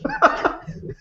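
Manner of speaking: short high burst of laughter. Real, not acted. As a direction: laugh at the absurdity. Laughing at own story.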